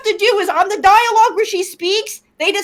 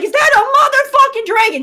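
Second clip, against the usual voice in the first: higher, louder mock alarm; imitated freakout; 6 semitones higher, 4 dB louder, much faster than usual. Player retells the woman coming out, mock freaked out. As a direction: act startled, pausing before the expletive. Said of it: "She's super freaked out".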